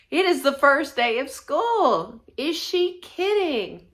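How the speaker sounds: high, slow, loud disbelief; exasperated protest. A narrator's reading. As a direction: drag it out in indignant complaint. Child protests work on first day.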